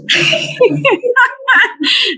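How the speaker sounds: real laughter at his joke; high laugh. Real, not acted.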